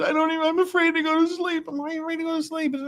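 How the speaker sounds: high, whiny frightened voice; scared girlfriend voice. Retold or imitated. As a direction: whimper it like a terrified girlfriend. Girlfriend afraid after quake.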